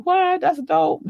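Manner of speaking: high-pitched mock amazement; imitated excitement. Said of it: "He don't be as pumped as I do".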